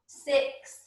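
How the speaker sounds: ordinary talk, nothing marked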